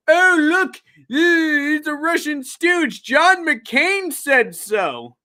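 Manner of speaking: high, sing-song mock of smug Democrats. Mocking Democrats to his cohost, high and whiny. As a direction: ham up a smug, whiny liberal, slowing to the end. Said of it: "all these Democrats going".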